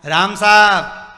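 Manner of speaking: loud, slow call across the path; hearty greeting. Shown as a demonstration. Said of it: "जरा बोल के देखना". (धीमे, ज़ोर से पुकारा गया "राम साहब")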